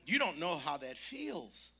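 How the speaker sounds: full-throated pulpit preaching; preaching at full force. Real, not acted. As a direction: drive each phrase home to the congregation. Preaching build to climax.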